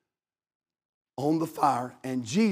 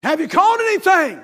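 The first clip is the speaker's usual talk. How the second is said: high, loud holler across the water; 13 semitones higher, 10 dB louder than usual. Retold or imitated. Jesus hollering from the shore to the fishermen. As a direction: call out to the boat from the shore. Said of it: "he hollers out and he says".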